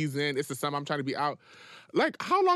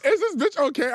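mock disbelief; 10 semitones higher, 7 dB louder than usual. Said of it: "I'm like".

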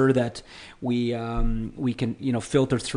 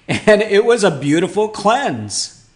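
laughing; 6 semitones higher, 3 dB louder than usual. Real, not acted. Laughing over getting soaked.

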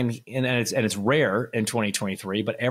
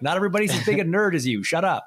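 higher and quick, teasing them to shut up; mock dismissive brush-off; 8 semitones higher, faster than usual. TV bosses teasing the data nerd, quick mock dismissal. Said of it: "And they're like".

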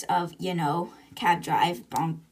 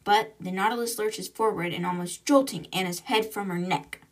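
ordinary delivery

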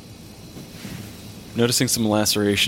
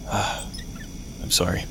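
quiet sigh; 7 dB quieter, much slower than usual. Acted. Ghost recalls being hit.

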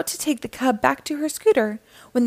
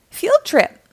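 much higher, louder cheer; excited exclamation; 12 semitones higher, 5 dB louder than usual. A narrator's reading. Bubble Guppies hearing they are going out, excited exclamation. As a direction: exclaim it like thrilled children. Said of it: "the Bubble Guppies exclaimed".

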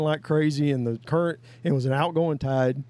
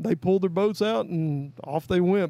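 usual speaking voice

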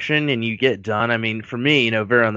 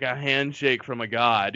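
ordinary delivery

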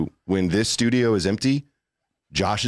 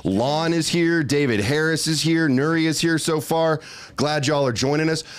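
bright upbeat shout-outs, higher; cheerful greeting; 6 semitones higher than usual. Real, not acted. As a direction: welcome the chat with energy. Greeting chat, raised voice.

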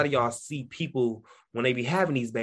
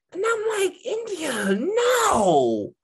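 emphatic refusal; 5 dB louder, much slower than usual. Retold or imitated. Rejecting friend's advice.

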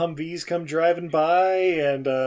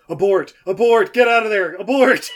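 loud, higher, urgent abort shouting; mock panicked yell; 5 semitones higher, 8 dB louder than usual. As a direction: yell the order down the earpiece. Real [name] yelling over earpiece.

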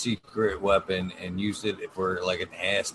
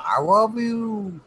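high, slow dog-like "I love you"; talking dog voice; 12 semitones higher, slower than usual. Retold or imitated. Voicing a dog saying I love you.

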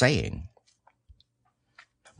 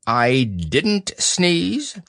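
high, very slow denial; Owl; 5 semitones higher, much slower than usual. Acted. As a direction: deny it slowly as an affronted owl. Owl denies sneezing.

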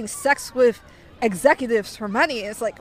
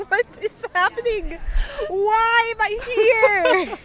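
high, drawn-out comic wail; mock despair; 9 semitones higher, slower than usual. Exhausted passenger trapped by a chatty seatmate, mock despair. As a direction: wail it slowly as if near tears. Retells despair at endless oversharing.

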